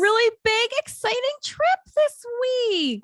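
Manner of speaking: high, stretched-out gushing; big singsong enthusiasm; excited. Real, not acted. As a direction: gush at your co-host, very high. Hyped opening to co-host.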